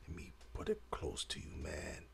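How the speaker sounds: soft, fast whisper into the mic; hushed aside while holding the horn close. Real, not acted. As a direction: lean in and whisper it quickly.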